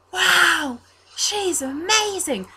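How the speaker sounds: put-on high, drawn-out childlike amazement; Reese. Altar boy admiring the bird on his arm, high awed voice. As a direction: marvel like a thrilled young boy. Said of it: "twelve-year-old-ish looking blonde altar boy".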